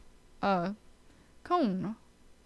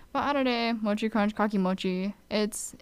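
ordinary talk, nothing marked

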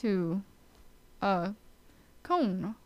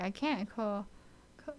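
ordinary talk, nothing marked

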